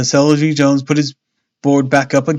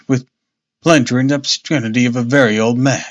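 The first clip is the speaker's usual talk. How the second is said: usual speaking voice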